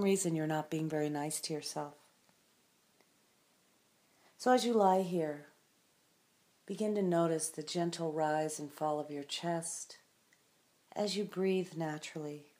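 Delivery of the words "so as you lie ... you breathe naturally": unhurried meditation voice with long silences; calm, spacious guiding of a resting listener; guided meditation. Acted. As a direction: guide the listener gently into stillness, leaving space after each phrase.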